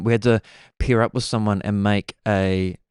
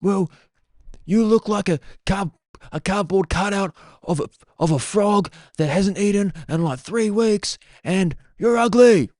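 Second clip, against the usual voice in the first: mock heckler; 10 semitones higher than usual. Mimicking a wrestling heckler, high and mocking. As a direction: sneer it out like a rowdy fan. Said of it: "They'll just be like".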